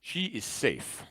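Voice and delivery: slow, portentous report in broken phrases; measured mission report with falling endings; cryptic radio contact, deliberate. Acted. Game master voices Big Bird.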